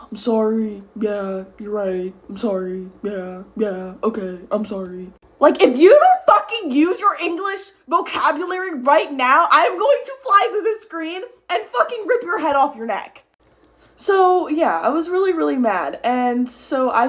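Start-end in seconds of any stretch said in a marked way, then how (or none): 0.1-5.1 low, monotone mumbled apologies; mocked passive boyfriend. 5.4-13.2 high, exasperated tirade; furious threat. Retold or imitated. Acts out furious threat to boyfriend.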